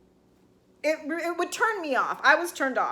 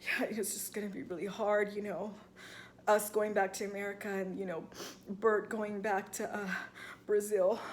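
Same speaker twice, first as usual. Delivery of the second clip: mock mopey Garrick; 4 semitones lower, 9 dB quieter than usual. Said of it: "and he's just like".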